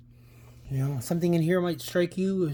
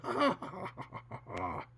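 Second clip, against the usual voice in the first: mocking echo laugh; 3 semitones lower, 8 dB quieter than usual. Ghostly sounds echoing from another world, mocking laughter. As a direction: let an insane laugh echo away. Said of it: "the mocking sort sounds continue echoing insanely".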